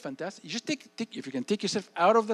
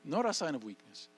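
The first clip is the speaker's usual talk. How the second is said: ordinary delivery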